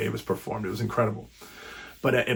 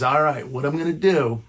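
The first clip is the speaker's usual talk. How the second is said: higher put-on voice of a smug club booker, a light imitation; a deliberately bad impression of the gatekeeper; Tommy accent; 4 semitones higher, 4 dB louder than usual. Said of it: "I'm doing the Tommy accent".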